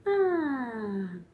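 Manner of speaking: ah sliding downward; falling ah. Shown as a demonstration. Therapist showing parents a falling sound, pitch going down. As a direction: let the ah fall. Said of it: "make it go down".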